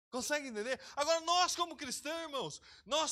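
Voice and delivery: fiery preaching. Acted. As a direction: press the congregation hard. Preaching at full force.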